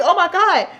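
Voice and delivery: high, loud laugh through the words; laughing disbelief. Retold or imitated.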